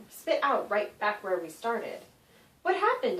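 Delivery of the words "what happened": startled ask. Acted. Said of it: "I asked".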